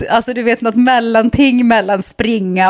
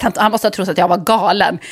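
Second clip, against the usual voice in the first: usual speaking voice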